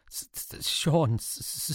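stammering through chattering teeth; stuttering with fear. Acted. Frightened soldier stammering his name to the officer. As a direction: stumble over his own name in fright.

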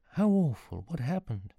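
high-pitched, dismayed reaction; acted shock and concern; shocked sympathy. Character reacts to mother's disappearance.

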